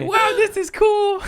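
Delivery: put-on very high delighted kid with laughter; squeaky amazed kid; little kid voice. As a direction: squeal with joy like a toddler. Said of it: "he's like".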